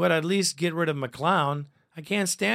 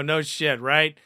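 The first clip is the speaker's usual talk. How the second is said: usual speaking voice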